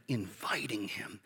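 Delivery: hushed, breathy-free whisper of inviting him. Real, not acted. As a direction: lean in and whisper it. Intimate closing of sermon.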